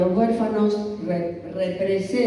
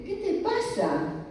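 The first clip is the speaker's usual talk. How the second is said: mother asking; 11 semitones higher, 5 dB quieter than usual. Retold or imitated. Mother asks crying daughter.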